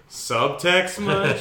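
slow, loud and high, dripping with sarcasm; sarcastic. Real, not acted. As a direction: drawl it out mockingly. Mocking the episode's obvious subtext.

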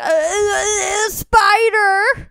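stammer, then wail a panicky excuse; mock panicked wail. Kid lying to dad downstairs, mock panicked wail. Child covering up after screaming.

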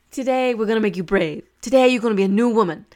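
loud, fast pep-talk imitation of the guide; flamboyant guide. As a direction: pump them up like a showman. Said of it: "really flamboyant person".

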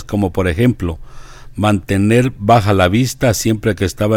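measured devotional biography narration; deliberate reading with pauses between phrases; reading aloud. Acted. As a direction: read it out clearly, phrase by phrase.

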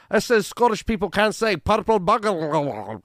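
put-on a light Scottish accent; mock Scottish accent. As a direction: put on a Scottish voice reading a claim. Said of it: "They go".

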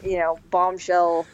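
usual speaking voice

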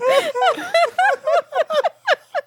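high squeaky SpongeBob laugh; high cartoon laugh. Failed SpongeBob laugh attempt.

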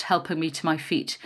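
usual speaking voice

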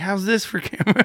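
high, loud retold retort; defiant comeback. Retelling comeback to the filming driver, loud and defiant. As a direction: throw it back at him, high and loud.

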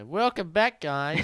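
high, loud podcast intro; host's welcome back. Pretend. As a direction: belt out a cheery show re-opening. Mock podcast host re-intro.

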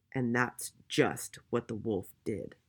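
plain reading voice

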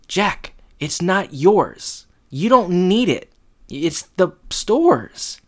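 hammed-up pleading protest; high scolding voice. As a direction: scold Jack in a put-on voice. Voicing objection to Jack's gift.

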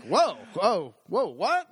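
swooping high "whoa"s, half laughing; comic retold shock; startled. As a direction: recoil from being cursed at. Startled by the nurse's cursing.

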